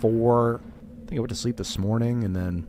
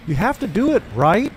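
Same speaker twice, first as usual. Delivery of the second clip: high, rising mock voice pressing for agreement; mock pushy questioner; 11 semitones higher, 5 dB louder than usual. Mocking a guest who pushes him with right. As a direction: imitate someone fishing for a yes. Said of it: "people I would respect would be like".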